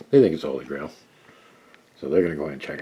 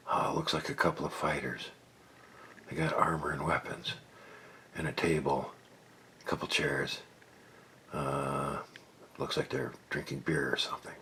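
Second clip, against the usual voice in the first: hushed report; 2 semitones lower, 12 dB quieter than usual. Acted. Buffy whispers to Kaylor what she sees around the corner. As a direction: report back quietly so the guards don't hear.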